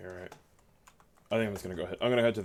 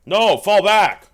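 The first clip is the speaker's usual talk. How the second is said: high, loud urgent yelling; frantic retreat order; 9 semitones higher, 15 dB louder, slower than usual. Acted. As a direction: scream at the men to fall back. Retreat under fire, yelling.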